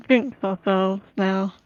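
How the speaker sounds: ordinary talk, nothing marked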